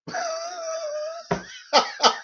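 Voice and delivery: shrill giggling squeal; high squeal of laughter. Real, not acted. Laughing at own Roger Moore joke.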